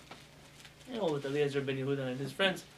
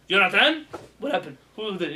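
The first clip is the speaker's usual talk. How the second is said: a touch of a scolding teacher, louder on "Yonatan"; mock upset teacher; 4 semitones higher, 8 dB louder than usual. Teacher upset at a pupil, mildly mock scolding. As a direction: lightly play the annoyed teacher. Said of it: "if your teacher is upset at you".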